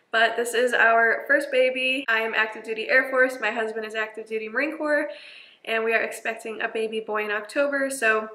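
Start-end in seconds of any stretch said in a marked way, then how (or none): none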